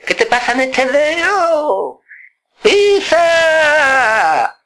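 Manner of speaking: demanding uncle ordering him to press the string, high; high stern uncle. Retold or imitated.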